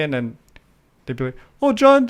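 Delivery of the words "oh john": high teasing "Oh, John" much higher; mock bully taunt; mocking sing-song. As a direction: tease his name like a schoolyard bully.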